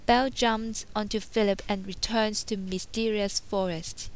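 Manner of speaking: ordinary delivery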